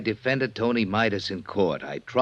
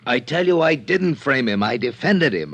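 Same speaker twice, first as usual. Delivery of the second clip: raised, insistent denial, louder and higher; heated protest; 4 semitones higher, 7 dB louder than usual. Acted. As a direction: protest hard, almost shouting. Engel protests his innocence heatedly.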